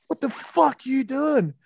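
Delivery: high, drawn-out mock outrage; having a go. Surfer confronting the mate who cut in, mock angry. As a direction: imitate a surfer having a go, pitched right up. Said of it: "has a go at him".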